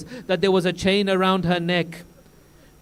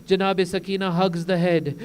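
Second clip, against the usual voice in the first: ordinary talk, nothing marked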